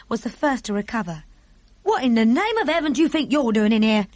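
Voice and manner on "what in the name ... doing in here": outraged maid, raised pitch and volume; angry shout. A narrator's reading. Maid confronts girl on the windowsill, angry shout. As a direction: scold an intruder loudly. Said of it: "she shouted angrily to Sophie".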